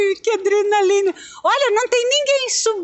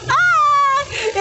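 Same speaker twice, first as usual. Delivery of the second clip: startled cry; 8 semitones higher than usual. Real, not acted. Car jolts.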